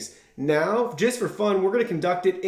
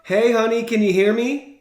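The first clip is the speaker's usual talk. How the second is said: calling out; 5 semitones higher, 5 dB louder than usual. Real, not acted. Calling wife on speakerphone.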